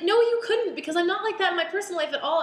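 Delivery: high, loud mock-indignant comeback; indignant retort. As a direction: fire back in high mock outrage. Retorting to people in retold thought.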